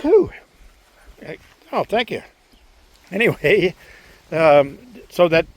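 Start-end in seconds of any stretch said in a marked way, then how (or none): 0.0-0.3 a real sneeze; sudden sneeze bursting out; sneezing. Real, not acted. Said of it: "Excuse me, I'm gonna sneeze".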